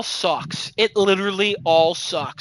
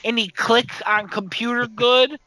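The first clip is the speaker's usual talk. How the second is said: higher-pitched mock simpleton; dim-witted writer; 3 semitones higher, slower than usual. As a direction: play a clumsy, barely literate coworker. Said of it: "a bunch of stupid shit".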